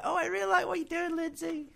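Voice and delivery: very high mum falsetto; imitated mum. Bandmate imagining Lindsay's mum praising her posts, high put-on mum voice. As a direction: put on an approving mother's high voice. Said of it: "your mum's like".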